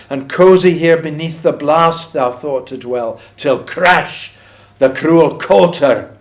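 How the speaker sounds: poem recitation. Acted. Lecturer recites the plough striking the mouse's nest, dramatic poem recitation. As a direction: build dramatically towards the crash.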